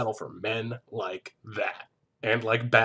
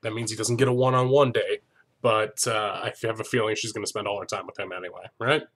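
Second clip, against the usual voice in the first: ordinary talk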